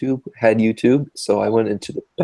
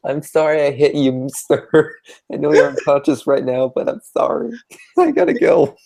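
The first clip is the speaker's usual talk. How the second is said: high, loud, giggly fake apology climbing ever higher; mock old lady; 6 semitones higher, 4 dB louder than usual. Friend mocks the lady apologizing to knocked-out boy, high giggling voice. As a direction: ham up a flustered lady fleeing the scene. Mocking the lady after accident.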